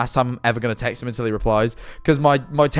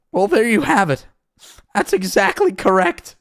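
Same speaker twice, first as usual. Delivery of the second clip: high, emphatic, slowing impression; Jordan Peterson; 9 semitones higher, 3 dB louder than usual. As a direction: agree in a high, overwrought voice. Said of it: "do my best impressions".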